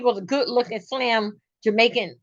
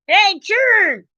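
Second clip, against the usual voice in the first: high, drawn-out country call; country holler; 6 semitones higher, slower than usual. Acted. Country woman calling the children in, country holler. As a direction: holler for the kids country-style. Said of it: "They know you got country in here".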